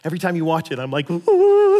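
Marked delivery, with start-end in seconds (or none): 1.1-1.8 a loud, high thrilled "ooh"; excited ooh. Pretend. Preacher reacts to Gandalf's arrival with a thrilled ooh. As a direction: gasp with delight like a fan at the movie. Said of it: "you know why I do that?".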